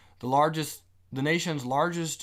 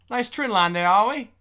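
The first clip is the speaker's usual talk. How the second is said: high, loud mock British accent; cheeky put-on British, rising high; 8 semitones higher, 8 dB louder than usual. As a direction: push the voice up into amateur British. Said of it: "why does my voice get higher".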